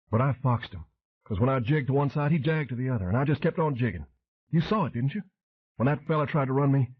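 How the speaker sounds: high, excited Southern [name] voice, talking fast; excited Pelvis shouting. A narrator's reading. Pelvis boasting to Flint about dodging the car: excited high Southern. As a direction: babble it, proud and wound up. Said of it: "Pelvis shouted".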